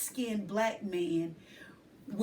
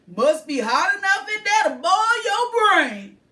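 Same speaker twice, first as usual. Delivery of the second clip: higher, louder friendly man; man's voice; 8 semitones higher, 12 dB louder than usual. A narrator's reading. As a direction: warn the boy good-naturedly. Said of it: "he suggests".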